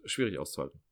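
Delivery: quietly worn out; sighing low murmur. Real, not acted. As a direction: drop to a low, soft murmur. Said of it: "wie gesagt".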